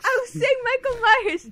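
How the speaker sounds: high, playful character voice; put-on voice. Correcting a friend in jest, high put-on voice. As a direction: pitch it right up. Joining in the impression game.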